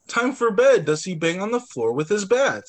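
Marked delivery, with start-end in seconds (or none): 0.1-0.8 firm call. Acted. Parent's command in story.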